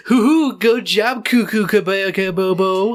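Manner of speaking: high, loud babbling send-up; hammed-up nonsense chant; mock gibberish nonsense. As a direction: blurt silly singsong gibberish at full volume. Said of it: "you're not like".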